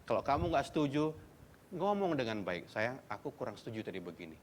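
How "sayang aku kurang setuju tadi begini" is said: soft, gentle and quiet; respectful disagreement. Shown as a demonstration. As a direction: disagree tenderly, keeping respect. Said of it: "Itu namanya double respect". (tidak setuju dengan lembut dan pelan)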